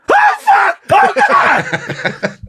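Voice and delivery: very high screamed exclamations breaking into laughter; reenacted scream. Fan reliving Gino's home run, screaming. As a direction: scream as if crying wolf. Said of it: "it was like crying wolf".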